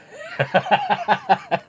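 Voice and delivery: a burst of high, loud laughter at his own joke; big laugh. Real, not acted. The man cracking up after telling friends about the prank, loud laugh. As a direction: crack up at the punchline.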